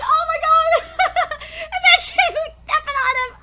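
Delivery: high, loud laughing through words; shrieking laughter. Real, not acted. Fan delighted by the panty scene, laughing shrieks. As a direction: laugh helplessly.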